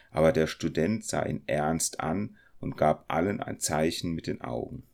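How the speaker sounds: plain reading voice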